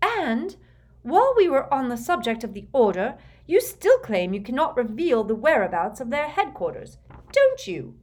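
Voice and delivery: high, heated accusation rising to a shrill end; loud, accusing challenge; snarling. A narrator's reading. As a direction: press the attack angrily. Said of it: "snarled Bellatrix".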